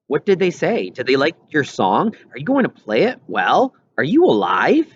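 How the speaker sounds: put-on a curious kid friend pressing for news; eager friend Wendell. A narrator's reading. Wendell's eager chat messages voiced.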